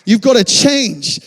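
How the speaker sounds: loud, high, insistent command; emphatic challenge from God; urgent voice of God. Pretend. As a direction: push it home, slowing on change. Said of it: "He's saying".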